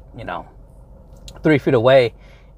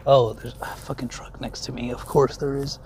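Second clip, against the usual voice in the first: breathy whisper, much softer, to himself; whispered aside; 2 semitones lower, 7 dB quieter than usual. Retold or imitated. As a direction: mutter it under your breath like a private discovery. Inner thought while parking.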